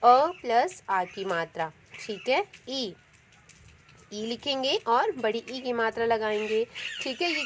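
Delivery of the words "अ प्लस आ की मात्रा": अ and आ said slowly and louder; slow, spelled out. Shown as a demonstration. Teacher to pupils, spelling out the vowel parts slowly. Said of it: "इनको आप तोड़ भी सकते हैं".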